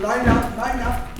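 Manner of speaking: loud, high call to line up; teacher calling class. Acted. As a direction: round up the kids.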